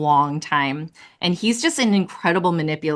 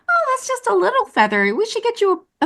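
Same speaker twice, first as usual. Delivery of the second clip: gentle high Big Bird voice. Retold or imitated. Voicing Carol as Big Bird.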